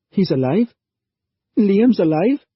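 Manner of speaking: high, loud hopeful disbelief; astonished. A narrator's reading. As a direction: gasp it out in surprise. Sal hears Liam lives.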